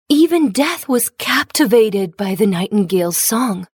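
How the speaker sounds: ordinary delivery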